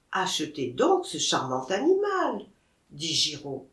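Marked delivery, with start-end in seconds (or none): none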